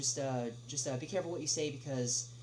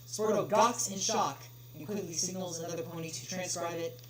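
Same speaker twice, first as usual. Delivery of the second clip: ordinary delivery, nothing marked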